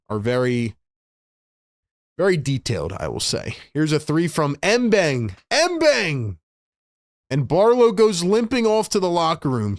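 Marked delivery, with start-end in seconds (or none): 3.7-5.3 brisk, raised play-by-play call; live play-by-play. Acted. Fan streamer calling the Sixers game for chat, play-by-play. 5.5-6.4 high excited yell of the name; excited call. Real, not acted. Streamer reacting to a three, excited high call. 7.3-9.8 brisk, raised play-by-play call; live play-by-play. Acted. Fan streamer calling the Sixers game for chat, play-by-play.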